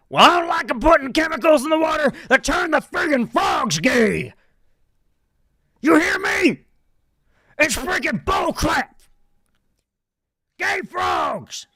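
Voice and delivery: high, loud, ranting shout; a frantic conspiracy rant, yelled high; Alex Jones impression. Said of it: "my Alex Jones voice".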